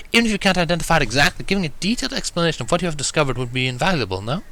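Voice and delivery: high ladylike voice bursting into a bright "Of course!"; high, refined reassuring tone; calm Rarity. A narrator's reading. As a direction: reassure a friend like a refined lady. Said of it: "Rarity reasoned calmly".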